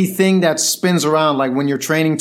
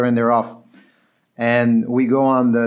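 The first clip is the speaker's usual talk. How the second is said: ordinary delivery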